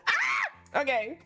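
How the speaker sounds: high, loud bursts of glee; excited squeal. Real, not acted. Thrilled about the game scene, squealing high and loud. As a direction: squeal it out, can't contain it. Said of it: "I'm so excited".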